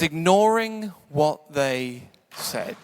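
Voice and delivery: very slow, "Ignoring" held long; drawn-out IGNORING. Retold or imitated. Preacher repeating the verse after a laugh, drawn out. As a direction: hold "Ignoring", then space out each word.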